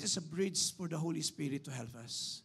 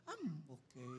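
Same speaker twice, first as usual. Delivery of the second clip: small, soft fake okay; acted brave face; 9 dB quieter, slower than usual. Hurt person answering whether they're okay, acted quiet understatement. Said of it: "hindi ka naman umaarte".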